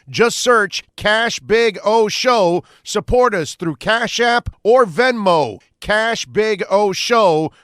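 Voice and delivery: loud, punchy ad read; loud promo read. Acted. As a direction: sell it like a radio promo.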